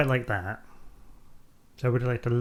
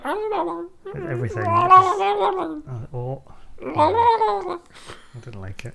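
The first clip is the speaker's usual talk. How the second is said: very high, slow, strained creature voice; Weasel voice; 6 dB louder, much slower than usual. Retold or imitated. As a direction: squeak it out slowly like a creepy little weasel. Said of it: "That's my Weasel impression".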